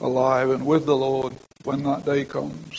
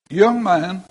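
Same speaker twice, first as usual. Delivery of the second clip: loud call; 4 semitones higher, 6 dB louder than usual. Retold or imitated. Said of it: "I'm sure he said it quite loudly".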